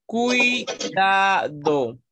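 "Cuidado" drawn out very slowly as cui-da-do; slow dictation of "Cuidado", cui, da, do; syllable by syllable. Shown as a demonstration. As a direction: chant out "Cuidado" in three separate beats, ui kept together. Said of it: "cuando las dos son cerradas".

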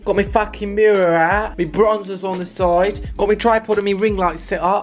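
lightly put-on exasperated rant; ranting mock northern voice. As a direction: play a fed-up northern lass moaning. Rants about messy room in put-on voice.

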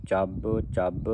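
ਚੱਬ ਚੱਬ slowly, each b held double; doubled consonant. Shown as a demonstration. Teacher shows both words take adhak, slow tight ਚੱਬ ਚੱਬ. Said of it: "ਇਹ ਦੋਨੇ ਸ਼ਬਦਾਂ 'ਤੇ ਅਧਿਕ ਲੱਗੇਗਾ".

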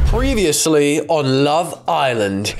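dramatic slow TV recap; TV announcer. Pretend. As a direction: voice it like a reality show recap. Reads the recap intro.

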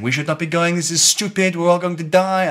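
put-on high, loud protesting French accent; Frenchman. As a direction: panicky mock-French objection. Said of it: "argument with the Frenchman because he was saying".

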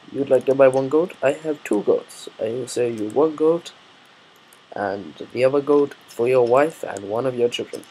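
loud mock Middle Eastern accent. Goat seller bargaining with a customer, Middle Eastern accent. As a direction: haggle loudly in a put-on Middle Eastern accent. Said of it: "doing that in a weird Middle Eastern, uh, accent".

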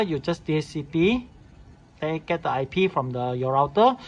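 normal talking voice

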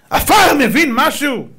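high, loud, drawn-out question; mock incredulity. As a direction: scoff loudly at the absurd idea. Mocks idea of feeling dust.

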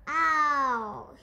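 loud pained yelp; snorting ow. Acted. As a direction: yelp as the fire water stings. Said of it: "he snorted".

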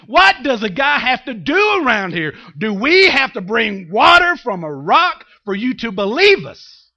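loud, high-pitched acted outburst; exasperated Moses. Moses fed up with doubting people, loud angry outburst. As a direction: explode in exasperated rhetorical questions. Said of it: "He's so mad. He's so exasperated".

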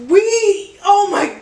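high, loud outburst; yelling. Real, not acted. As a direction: burst out over the music. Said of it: "Why am I yelling?".

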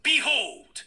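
hammed-up anime hero; dramatic anime voice. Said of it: "Dramatic anime voice".

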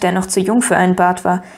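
ordinary delivery, nothing marked

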